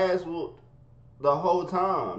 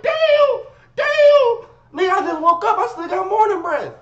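much higher and louder, yelling through the pain; 11 dB louder, slower than usual. Retold or imitated. A son being hit with a shoe, loud pained yelling. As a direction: wail like you're being hit.

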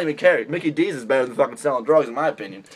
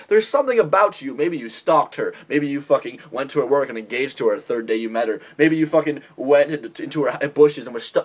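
normal talking voice

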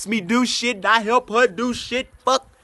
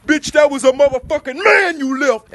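quoting people's scolding; 5 semitones higher, 4 dB louder than usual. Retold or imitated. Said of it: "those were some people's exact goddamn words".

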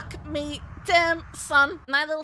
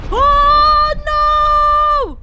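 loud, high, stretched cry of dismay; drawn-out wail; 9 semitones higher, 10 dB louder than usual. Real, not acted. Long cry as death comes.

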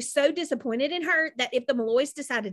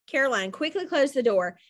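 ordinary delivery, nothing marked

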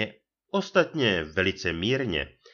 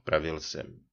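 ordinary delivery

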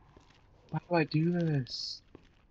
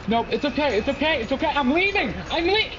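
panicked retreat; 13 semitones higher than usual. Real, not acted. Backing off from a monster, she panics and flees. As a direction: talk yourself down, then bolt.

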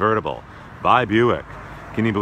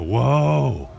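excited whoa; 4 semitones higher, 4 dB louder than usual. Real, not acted. Gushing reaction.

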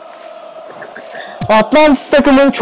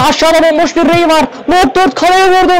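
quickening, climbing toward the shot; excited play-by-play; 5 semitones higher than usual. Real, not acted. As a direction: raise the pitch as the chance opens. Calling a shot on goal. (şuta doğru yükselen heyecanlı anlatım)

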